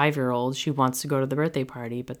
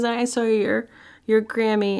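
put-on high, louder childlike report; higher childlike matter-of-fact voice; little girl; 8 semitones higher, 4 dB louder than usual. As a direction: voice the five-year-old, up high. Daughter reports seeing grandmother.

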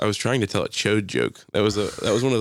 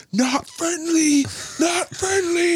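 much higher, very slow sung warning; meme sound voice; much slower than usual. Retold or imitated. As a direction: drawl it like the TikTok sound. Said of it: "the sound in the background's like".

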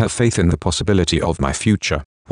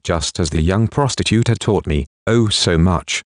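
ordinary delivery, nothing marked

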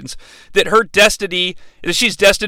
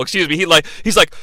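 usual speaking voice

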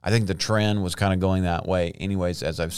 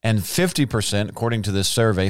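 usual speaking voice

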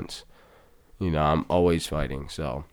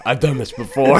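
higher, louder hammed-up nervous uncle; mock nervous uncle; 6 semitones higher, 5 dB louder than usual. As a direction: fake confidence, a bit panicked. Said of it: "So he's like".

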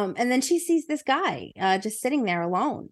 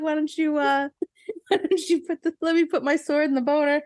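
laughing through words; 7 semitones higher than usual. Acted. Knight's line, speaker cracking up.

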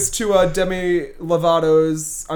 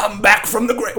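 higher first try at a jazz singer impression; 4 semitones higher than usual. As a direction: launch into a mock celebrity voice. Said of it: "[name] would be a perfect choice".

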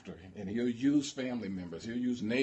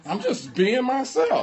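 high, loud protest of innocence; hammed-up wounded comeback; mock defensive; 13 semitones higher, 9 dB louder than usual. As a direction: plead innocence in a comic high pitch. Acted defensive reply in quarrel.

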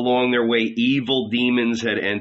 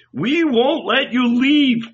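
high, loud, drawn-out protest; slow, insistent cry of refusal; alarmed cry; 13 semitones higher, 5 dB louder, slower than usual. A narrator's reading. Disciples clinging to their rabbi, crying out. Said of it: "we cried".

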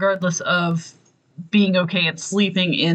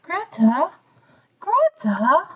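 put-on very high, childlike creepy call; little voice; 11 semitones higher, slower than usual. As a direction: imitate a tiny child calling a name. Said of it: "you hear the little voice again".

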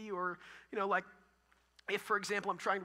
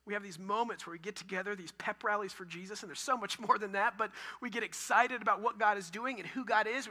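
ordinary talk

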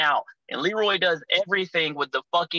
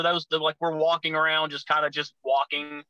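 normal talking voice

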